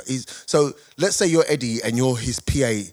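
ordinary delivery

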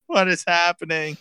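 frightened whine, wanting out; imitating his own helpless panic; panicked. Panicking at the fight.

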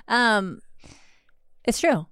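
usual speaking voice

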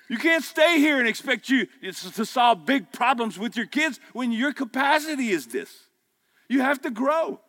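high, loud, slowed preacher's cry on grow; full-force preaching. Acted. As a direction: preach it hard to the men, rising to a roar.

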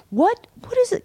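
high-pitched mock bafflement; imitated puzzlement. Friend puzzling over a strange gift, in high mock confusion. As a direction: act out a baffled, rising question. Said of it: "he couldn't figure it out at first".

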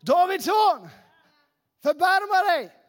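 high, loud desperate call; loud crying out. Acted. Blind beggar calling to Jesus passing through town, loud high shout. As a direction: shout for mercy over the crowd. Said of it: "Så han ropar ännu högre".

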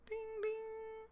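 high-pitched phone ding; imitated text alert. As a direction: sing out a high notification chime.

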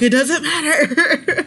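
high, slow, laughing outburst; freak-out quote. Retold or imitated. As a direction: stretch it out in a mock freak-out. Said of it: "I'm like".

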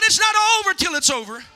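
loud, fast preacher's shout; belted, quick-fire rallying cry. Shown as a demonstration. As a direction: shout it out to rouse the congregation. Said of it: "Come on, shout".

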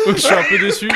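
mock-sad line cracking up with laughter; laughing. Real, not acted. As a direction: say it while laughing hard. Mock disappointment through laughter.

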